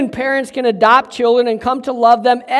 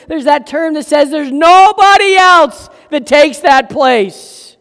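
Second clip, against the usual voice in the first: fervent preaching; 5 semitones higher, 6 dB louder than usual. Acted. Preaching climax.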